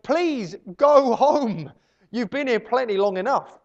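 loud, high, drawn-out begging; mock exasperated plea. Host begging lingering wedding guests to leave, mock exasperation. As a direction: plead like a fed-up host. Imagined fed-up host pleading.

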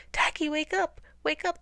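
high, insistent wake-up call; high-pitched urgent repeating; urgent cry. A narrator's reading. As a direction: plead frantically with a sleeper. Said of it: "they repeated".

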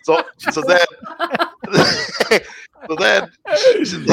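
high, laugh-choked false starts; words breaking into real giggles; laughing while talking. Real, not acted. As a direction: try to carry on through laughter. Laughing at own story.

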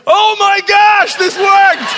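high, loud, stretched shout of amazement; excited shout. Retold or imitated. Preacher shouting his amazement that the prayer worked. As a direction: burst out, thrilled it worked.

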